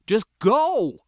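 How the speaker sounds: loud, high-pitched shouted order; urgent shout. A narrator's reading. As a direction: yell it to get the driver moving. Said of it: "I shouted".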